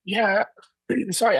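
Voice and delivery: losing his voice, hoarse; strained, hoarse throat. Real, not acted. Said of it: "I've been losing my voice".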